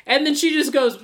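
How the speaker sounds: normal talking voice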